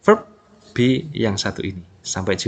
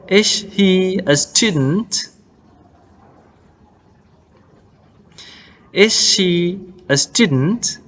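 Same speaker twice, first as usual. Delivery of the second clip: slow questions, each climbing on the last word, "student", "students" or "book"; rising question intonation; 4 semitones higher, much slower than usual. Shown as a demonstration. Teacher drills learners on English questions, slow rising intonation. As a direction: model each question slowly, lifting "student" or "book" at the end. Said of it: "Di akhir dia naik ya".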